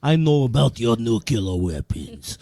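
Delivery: broad comic Russian; heavy put-on Russian, broken English; Russian accent. Russian boasting about weapons to friends, Russian accent. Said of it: "We could talk Russian".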